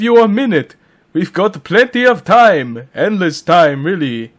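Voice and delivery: high, swooping vampire count; Dracula. Pretend. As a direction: tease someone in a theatrical vampire voice. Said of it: "Dracula will be voiced by Dracula".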